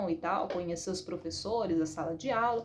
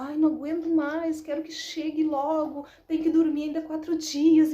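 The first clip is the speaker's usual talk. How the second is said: higher, louder whine of impatience; mock-impatient kid, pitched up and loud; impatient child; 6 semitones higher, 6 dB louder than usual. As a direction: imitate a child who can't wait. Acts out impatient daughter's pleading.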